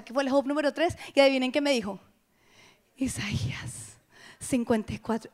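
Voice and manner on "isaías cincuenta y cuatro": slow hushed whisper; whispered in awe; awed whisper. Retold or imitated. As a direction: reveal it like a secret. Friend's answer revealed in awe.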